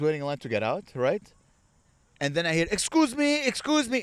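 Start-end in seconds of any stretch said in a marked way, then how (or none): 2.8-4.0 high-pitched, insistent mock politeness; pushy husband. Guy with his wife pushing off the ferry, imitated insistence. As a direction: pitch it up to cut through a queue. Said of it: "It's just a guy and his wife".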